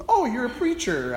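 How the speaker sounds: high, chirpy question; put-on brightness to placate a stranger; bright, humoring. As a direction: humor him with fake cheer. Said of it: "I asked brightly".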